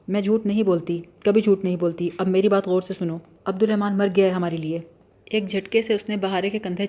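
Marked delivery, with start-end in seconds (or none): none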